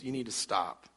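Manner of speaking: calm him down quietly; wife's soft warning. Retold or imitated. Wife calms angry husband.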